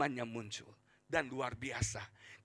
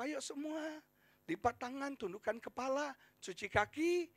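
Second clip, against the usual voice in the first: routine bedtime prayer; 11 semitones higher than usual. Retold or imitated. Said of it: "Doa yang normal itu".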